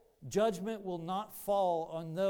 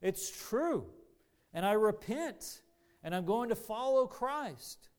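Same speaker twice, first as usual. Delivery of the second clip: louder, rising declaration of faith; crying out belief; 4 semitones higher, 5 dB louder than usual. Acted. New believers cry out in response to Paul, loud and convinced. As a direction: cry it out with conviction, building higher. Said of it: "They cried out".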